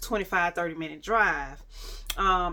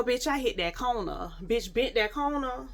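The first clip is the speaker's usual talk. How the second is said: ordinary talk, nothing marked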